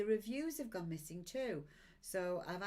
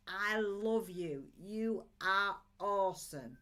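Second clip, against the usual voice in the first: slow, louder loving declaration; emphatic affection; 7 dB louder, slower than usual. Real, not acted. Reader telling viewers she loves them, slow and loud. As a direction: pour it out to each viewer, big and slow.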